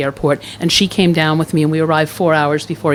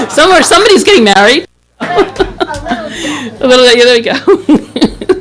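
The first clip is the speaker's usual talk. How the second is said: amused, higher-pitched ad-lib through laughter; laughing; 8 semitones higher than usual. Real, not acted. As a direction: crack up while riffing on the interruption. Interruption gets everyone laughing.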